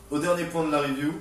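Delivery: ordinary talk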